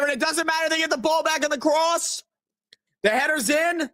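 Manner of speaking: rising thrill, calling the header live; excited commentary. Real, not acted. Streamer calling the attack to chat, excited high call. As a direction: pitch jumps way up as the cross comes in.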